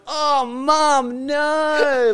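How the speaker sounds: put-on high, loud kid voice; kids protesting. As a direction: squeal it like an embarrassed kid. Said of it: "the kids are going".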